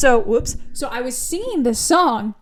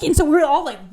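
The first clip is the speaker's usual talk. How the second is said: ordinary delivery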